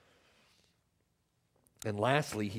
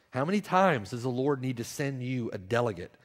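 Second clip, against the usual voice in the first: ordinary talk, nothing marked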